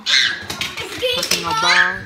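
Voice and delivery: very high and very slow, stretched out; sing-song it way up high and drag every sound; goofy high stretched catchphrase; silly drawn-out catchphrase. Pretend. Kid repeats catchphrase, drawn out.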